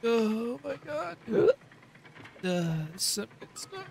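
slow, high tipsy gasp; drawn-out drunk wonder; giggly drunk. Pretend. Pretend drunk in game.

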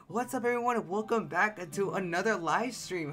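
bright hyped stream greeting; streamer intro. Acted. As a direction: welcome the viewers like a host.

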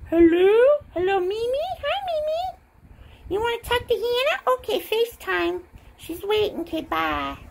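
high, rising phone greeting; pretend phone call.

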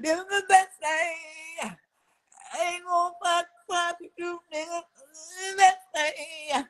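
high sing-song imitation of a cheery influencer; mock bubbly sign-off. Imitating a woman ending her livestream, high and bubbly.

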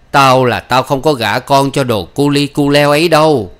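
loud, emphatic refusal; angry father. Acted. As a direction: lay down the law to the daughter. Father forbids the match.

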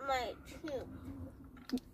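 pained mumble through candy; eating sour candy. Real, not acted. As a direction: mouth full of candy, tooth aching. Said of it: "it did hurt my tooth".

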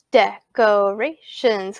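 "decorations" slowly in four beats; syllable by syllable. Shown as a demonstration. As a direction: separate each syllable of "decorations". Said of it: "listen very carefully to how I say those".

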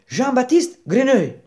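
very high, loud announcement; eager Grenouille. Acted. Grenouille giving Baldini his full name, proclaiming. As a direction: proclaim the name, pitch soaring.

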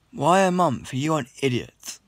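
ordinary talk, nothing marked

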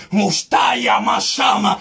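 slow drawn-out ecstatic tongues; speaking in tongues. Real, not acted. Preacher breaks into tongues mid-sermon, slow ecstatic tongues. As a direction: let the tongues flow out slowly.

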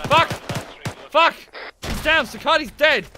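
loud, high panicked callout; alarmed shout. Real, not acted. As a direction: shout out the loss. Teammate killed mid-fight.

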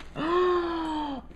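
long, high gasp of amazement; amazed gasp. Real, not acted. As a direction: gasp in real astonishment. Astonished at gift box.

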